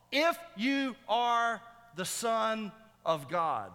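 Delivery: slow, high, word-by-word taunt; mocking challenge. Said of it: "this mocking statement".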